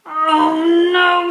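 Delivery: loud, high cry of dismay; exasperated Mister Bear. Acted. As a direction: groan it out like a fed-up bear. Fed-up bear exclaims in story.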